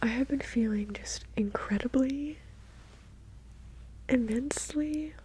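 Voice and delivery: close, unvoiced whispered chat; ASMR whisper. Real, not acted. ASMR creator chatting to viewers in a whisper. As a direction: whisper intimately into the mic.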